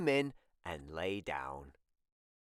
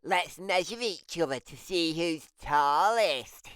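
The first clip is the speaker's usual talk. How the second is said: higher, louder little-brother voice; Tim's complaint; 6 semitones higher, 3 dB louder than usual. Acted. Tim complaining he was not told, higher and louder. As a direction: whine it like a left-out kid.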